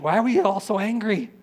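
high, loud baffled plea; acted exasperation, pitch and volume up; exasperated outcry. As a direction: cry it out in frustration. Acting exasperated thought about everyone's anger.

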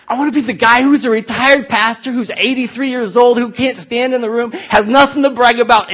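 excited declaration to the congregation; fired-up preaching. Real, not acted. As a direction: preach it out with rising passion. Passionate preaching about his hope.

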